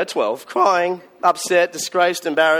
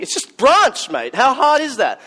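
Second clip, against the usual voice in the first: higher, louder, exasperated at a slow guesser; exasperated outburst; 8 semitones higher, 4 dB louder than usual. Retold or imitated. Boy fuming at his friend's wrong guesses, higher and louder exasperated outburst. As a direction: let the frustration boil over at the idiot friend.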